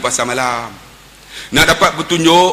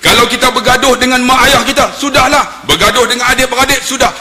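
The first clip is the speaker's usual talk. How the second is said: high, loud preaching; forceful, high-pitched proclamation; khutbah delivery; 8 semitones higher, 4 dB louder than usual. Retold or imitated. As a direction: raise your voice like a Friday preacher. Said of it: "buat nada sayu sikit".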